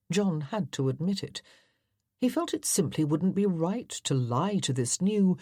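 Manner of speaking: ordinary delivery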